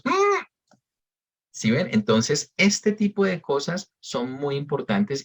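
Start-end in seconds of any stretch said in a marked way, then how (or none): 0.0-0.5 very high hummed "Mm" with meaning; "Mm" as a high, pointed hum; knowing Colombian "Mm". Shown as a demonstration. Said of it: "qué queremos decir cuando decimos".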